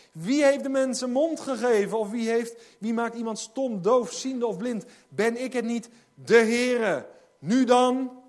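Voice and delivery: loud, commanding voice of God from the pulpit; forceful preaching of the divine reply; preaching God's words. Acted. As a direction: thunder God's challenge at the hall.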